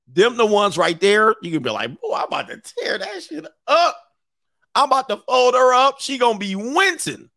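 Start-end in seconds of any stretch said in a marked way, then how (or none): 2.0-7.3 high-pitched, hyped-up mock bravado; hammed-up swagger, pitched way up; cocky boasting. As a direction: boast like an overexcited guy. Acted cocky man boasting.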